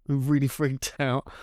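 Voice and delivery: usual speaking voice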